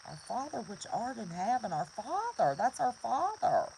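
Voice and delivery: heartfelt prayer, "Father" pressed home; said with meaning. Shown as a demonstration. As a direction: repeat "our Father" with rising conviction and force. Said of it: "say it with meaning".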